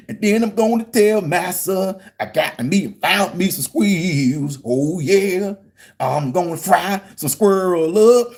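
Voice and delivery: slow, stretched-out Southern caricature, half sung; hammed-up rural Southern singsong; caricatured old Southern dialect. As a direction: drag out each word in a hokey Southern drawl. Demonstrates the put-on dialect as act.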